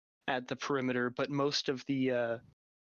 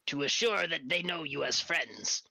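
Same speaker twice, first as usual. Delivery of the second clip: lightly higher formal creature voice; newt warrior voice; 4 semitones higher than usual. Acted. Newt warrior to the party, lightly higher formal voice. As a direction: state it with stiff formality. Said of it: "strider and states".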